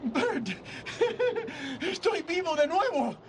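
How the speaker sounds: laughing giddily through the words; delirious happy laugh; laughing with joy. Acted. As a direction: burst out laughing, overjoyed. Overjoyed George laughs.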